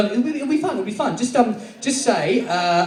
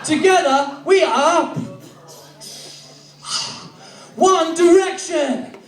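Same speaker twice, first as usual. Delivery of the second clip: grand, drawn-out team declaration; heroic team call; 9 semitones higher, much slower than usual. Acted. Band uniting to defeat their foe, heroic team call. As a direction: proclaim the team name slowly, pitched up.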